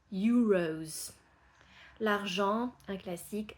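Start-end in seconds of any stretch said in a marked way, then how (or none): 0.1-1.1 "euros" starting with a Y sound; English euros. Shown as a demonstration. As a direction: say "euros" as yooros. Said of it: "Des euros".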